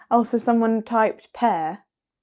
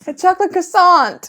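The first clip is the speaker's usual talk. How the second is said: laughing; 8 semitones higher, 7 dB louder than usual. Real, not acted. Repeating it, laughing.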